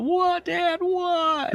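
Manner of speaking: put-on high, pleading cry of a boy; mock the son's wail, way up high. Son crying out to his father, high wail. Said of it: "Andy cries out".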